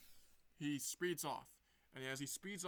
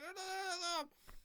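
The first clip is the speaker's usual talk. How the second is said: mock pained cries. Hit by electric net.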